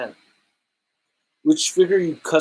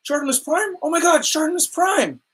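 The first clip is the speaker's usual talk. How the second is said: put-on excited kid; 10 semitones higher than usual. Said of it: "Sky was like".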